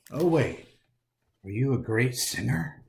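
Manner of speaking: plain reading voice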